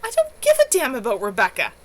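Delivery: loud, angry denial. A narrator's reading. Kevin snapping back at the circle about Rebecca, loud and angry. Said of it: "Kevin said angrily".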